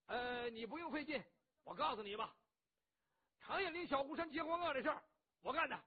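loud, high brash blusterer voice; a boisterous hothead boasting; rough loud bandit. Acted. Cheng Yaojin tells Qin Qiong he saved him, brash and loud.

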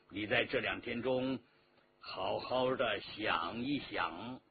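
low, measured menace; threatening emperor. Acted. Emperor gives his captive two days to decide, coldly threatening.

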